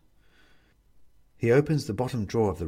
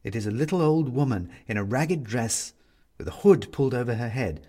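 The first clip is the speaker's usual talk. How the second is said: usual speaking voice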